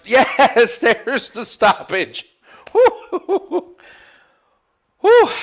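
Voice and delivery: high, loud laughing whoops; laughing cheer. Real, not acted. Fight stopped, celebrating.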